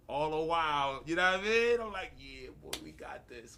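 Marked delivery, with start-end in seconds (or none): 2.2-3.6 soft, under-the-breath pep talk; quietly psyching himself up, retold; hushed hype. Retelling hype to friends, low.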